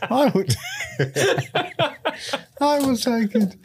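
high, laughing hero vow; mock heroic vow. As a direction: swear it through giggles.

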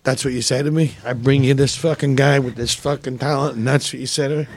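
low, menacing indignation, imitated; Dice. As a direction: drop the voice right down and confront him. Dice confronting the agent, retold.

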